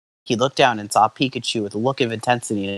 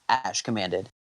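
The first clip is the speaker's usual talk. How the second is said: ordinary delivery, nothing marked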